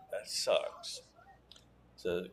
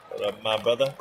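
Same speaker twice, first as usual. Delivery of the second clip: louder, hearty hello; loud greeting; 11 dB louder, much faster than usual. Real, not acted. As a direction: call out to a friend. Greeting a viewer.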